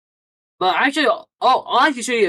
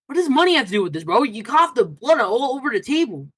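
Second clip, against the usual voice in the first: higher, louder incredulous retort; exasperated disbelief; 7 semitones higher, 4 dB louder than usual. Retold or imitated. Streamer retells answering his friend, exasperated and loud. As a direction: throw it back at him in disbelief, raised.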